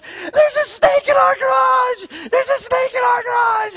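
hammed-up high, loud shriek of alarm, words stretched; panicked scream imitation. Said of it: "she comes running in, and she goes".

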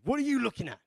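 loud, high mock teen challenge; hammed-up teenage bully shout; rowdy teenager. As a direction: imitate a rowdy kid picking a fight. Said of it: "a bunch of rowdy teenagers".

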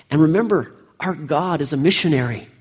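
ordinary talk, nothing marked

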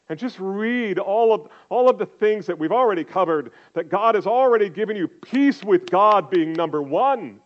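preacher urging the church to receive God's love; fervent preaching. Acted. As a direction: press the call home to the hearers. Preaching at full force.

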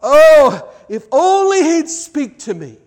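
high, loud, hammed-up wail of longing; mock lament. Preacher voicing a listener who feels far from God, mock wailing. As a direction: cry it out like a mock despairing believer. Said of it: "you're saying to yourself".

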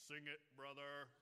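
booming amen voice. Retold or imitated. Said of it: "his amen voice".

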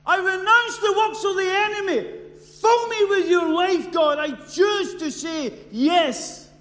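loud, high, slow crying out to God; shouted prayer of surrender. Retold or imitated. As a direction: cry out each line, slow and desperate. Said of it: "took years for me to surrender and realize".

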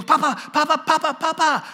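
put-on high, loud child calling papa; toddler calling papa. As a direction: cry out papa like a two-year-old. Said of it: "Stephen goes".

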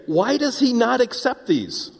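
high-pitched, aggrieved protest, acted out; mock wailing complaint. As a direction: whine upward as the wronged worshipper. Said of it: "weeping and wailing and moaning and complaining".

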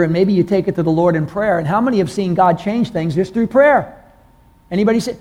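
ordinary talk, nothing marked

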